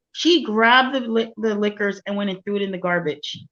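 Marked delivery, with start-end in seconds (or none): none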